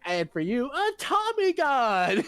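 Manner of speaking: rising to a high, slow, loud announcement; gleeful movie quote. Retold or imitated. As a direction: hand out the gift with manic glee. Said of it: "he's just like".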